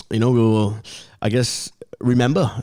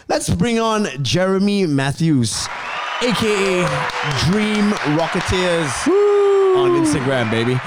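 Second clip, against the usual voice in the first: hype announcer; 6 semitones higher than usual. Acted. Hyped guest introduction.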